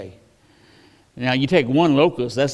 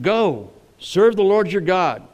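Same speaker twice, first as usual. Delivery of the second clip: slow, higher, louder royal dismissal; a king giving in through his teeth; grudging Pharaoh; 5 semitones higher, 3 dB louder, slower than usual. Acted.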